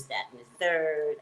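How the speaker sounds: ordinary talk, nothing marked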